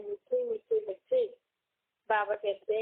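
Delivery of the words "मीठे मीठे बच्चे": loving fatherly address. Retold or imitated. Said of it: "Shiv बाबा ब्रह्मा के तन द्वारा कहते".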